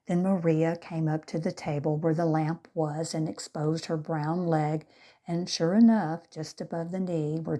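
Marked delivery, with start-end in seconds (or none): none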